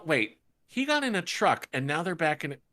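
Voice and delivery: ordinary delivery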